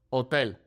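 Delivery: Hotel as oh-TEL; Spanish "Hotel", starts on the vowel; silent H. Shown as a demonstration. Teacher repeats the model, Hotel without h. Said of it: "the H is silent here".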